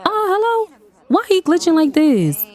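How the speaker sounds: high bewildered calling out; mock confused date. Date voiced when Amani glitches, mock confused. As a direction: play the puzzled date. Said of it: "She probably gonna be like".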